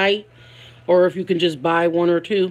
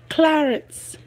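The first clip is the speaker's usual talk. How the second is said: put-on a light Spanish accent on Clarence, said higher; 6 semitones higher than usual. Chatting with viewers, imitating Queen saying Clarence in a Spanish accent. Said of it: "Queen got that, um, Spanish accent".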